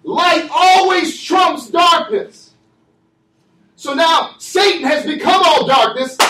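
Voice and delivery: fiery pulpit cadence, shouting the key words; preaching at full force. Acted. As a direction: drive it home to the congregation.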